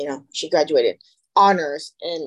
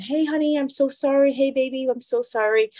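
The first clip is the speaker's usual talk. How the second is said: higher-pitched, coaxing apology; soothing apology; 7 semitones higher than usual. Retold or imitated. Boyfriend phoning his daughter about her car, high soothing apology. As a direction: imitate a dad placating his daughter.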